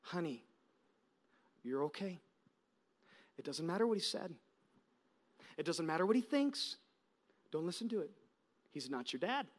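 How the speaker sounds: put-on soft, low reassurance to a crying child; tender whisper-soft comfort for a scared daughter. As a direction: hush and soothe her. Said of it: "This is what I said to her".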